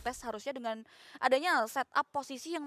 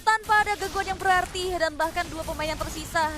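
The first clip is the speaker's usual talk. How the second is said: excited; 3 semitones higher, 7 dB louder than usual. Real, not acted. Co-caster recaps the fight.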